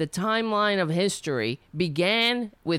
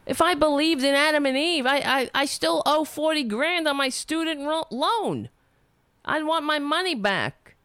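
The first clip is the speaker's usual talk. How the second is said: indignant retort; 7 semitones higher than usual. Retold or imitated. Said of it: "I was like".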